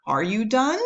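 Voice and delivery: "Are you done?" with pitch climbing high on done; rising question tune. Shown as a demonstration. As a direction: swing "done" up like a real question. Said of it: "So raising that pitch at the end".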